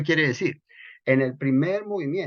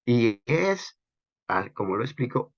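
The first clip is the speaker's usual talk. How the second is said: normal talking voice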